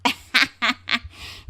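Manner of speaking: brother-in-law's hammed-up entrance laugh; put-on laugh. साले शीनू का आगमन. (बनावटी हँसी)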